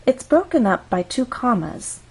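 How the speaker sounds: normal talking voice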